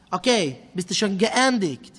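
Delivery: normal talking voice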